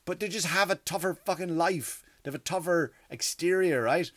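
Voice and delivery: ordinary talk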